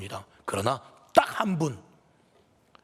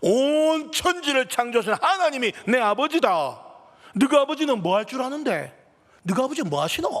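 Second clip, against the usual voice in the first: put-on a boy firing back with pride; bold retort; 9 semitones higher, 5 dB louder than usual. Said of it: "이 철수가 말해요". (철수가 놀리는 친구들에게, 당당하게 크게 외치며)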